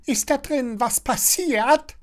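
very high, squawky busybody; high-pitched prattling neighbour; Frau Schnattermann chattering. A narrator's reading. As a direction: chatter it nosily through the door. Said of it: "schnattert Frau Schnattermann".

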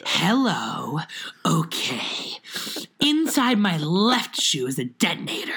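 a hint of a breathy diva, half-whispered. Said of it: "the idea of being like [name]".

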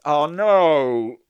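drawn-out, high mock wail; mock lament. Host failing his promise to be nice, mock anguish. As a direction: wail in pretend dismay.

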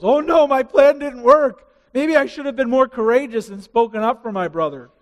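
lightly voiced guilty brother; a touch of panic over the failed plan; dismayed Reuben.

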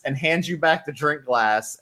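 ordinary talk, nothing marked

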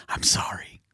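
soft, voiceless quoted apology; whispered apology. Retold or imitated. Grown man apologizing to his childhood picture, whispered. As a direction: whisper it like a private confession.

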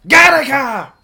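high, loud yell of Gattaca. Shown as a demonstration. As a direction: bellow Gattaca. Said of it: "I yell Gattaca a lot".

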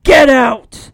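loud, harsh yell; big brother bellowing get out; shouted order. Retold or imitated. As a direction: blast it at the door. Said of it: "He tells her again to get out".

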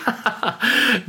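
high forced chuckle; mock nervous laugh. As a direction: fake a high, ingratiating laugh.

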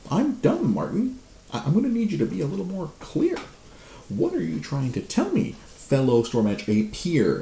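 high sarcastic feigned confusion at the coworker; sarcastic play dumb. Retold or imitated. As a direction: pretend to be clueless, drag it out. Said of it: "I'm like".

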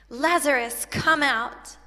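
calling out the dead man, loud and commanding. A narrator's reading. Preacher reading Jesus at the tomb, loud call. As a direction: throw the voice out to the tomb. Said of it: "called out in a loud voice".

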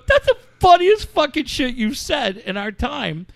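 high and loud delighted praise. Retold or imitated. Praising his friend's line, high and delighted. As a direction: rave about the line.

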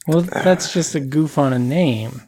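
ordinary delivery

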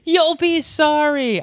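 high, drawn-out taunt; mock threat. Mimicking film character's taunting threat.

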